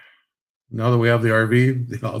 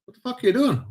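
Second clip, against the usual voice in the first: quoting buddy; 12 semitones higher, faster than usual. Retold or imitated. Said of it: "and he's".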